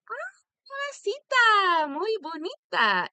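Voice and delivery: high, loud, put-on Spanish accent; bad Spanish. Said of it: "I don't really speak Spanish very well".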